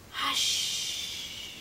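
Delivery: ordinary delivery, nothing marked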